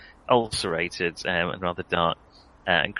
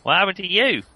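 raised-pitch Cornish farmer's question; Cornish old man; 9 semitones higher, 4 dB louder than usual. Acted. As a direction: play an old Cornish farmer sizing up strangers. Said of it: "only Cornish".

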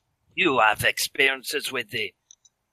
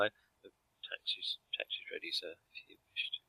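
quiet lackey whisper; 2 semitones lower, 16 dB quieter than usual. Acted. Lackey speaks in-story.

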